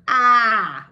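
big, loud, wide-open cry of AH; bigger pinched "ah". Shown as a demonstration. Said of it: "it's even that bigger".

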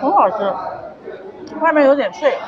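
talking between bites; chewing crispy fried food; mouth full, chewing. Real, not acted. Seen 0: Bites fried cake at start, then chews while speaking.

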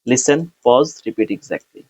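ordinary delivery

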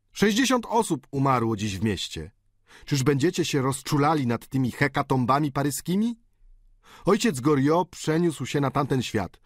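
lightly voiced tutor, impatient and cynical. A narrator's reading. Tutor lecturing the diners to stop talking of Goriot, a hint of impatience. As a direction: wave the dead man away.

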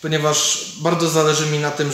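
ordinary talk, nothing marked

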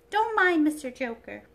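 high, loud dainty princess; a delicate china princess, high and bright; sweet airy princess voice. Acted.

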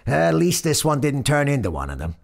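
high, loud griping; exasperated motorman. A narrator's reading. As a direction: complain loudly to a workmate. Brooklyn motorman grumbles to companion.

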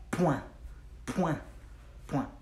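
"point" quick, in one short syllable; said fast. Shown as a demonstration. Teacher showing learners the French word at speed, quick and short. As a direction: say "point" fast as a single pwan. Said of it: "Say it fast".

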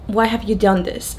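usual speaking voice

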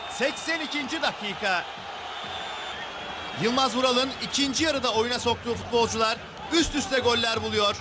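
ordinary talk, nothing marked

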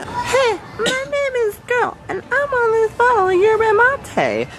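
very high squeaky cartoon voice; squeaky wall character. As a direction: speak as the picture on the wall, tiny and squeaky.

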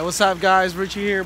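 ordinary delivery

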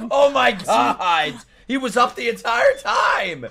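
high, loud, laughing outcry; incredulous shout; amused disbelief. Real, not acted. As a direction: exclaim it through laughter, astonished. Laughing disbelief at feigned sleep.

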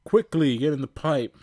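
urgent command. Acted. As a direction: hurry them away. Robot dragon urges escape.

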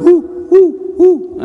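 high, loud hooting like an owl. Retold or imitated. Explaining the owl to the audience, high loud owl hoot.

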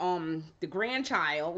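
usual speaking voice

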